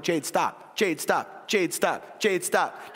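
firm parent repeating a command, slowly building; repeated order, starting contained. Retold or imitated. Parent telling daughter to quit gymnastics, contained but building. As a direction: keep repeating it at talking level, inching up. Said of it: "I'm getting louder and louder and louder".

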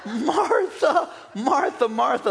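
high name call falling each time; lightly mock the Lord calling with a laugh; Jesus calling. The Lord calling Martha three times with a laugh. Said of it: "the Lord answered and says".

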